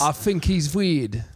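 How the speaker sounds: slow, high mock London voice; London accent. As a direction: drag it out in comic London English. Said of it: "they do talk like that in London".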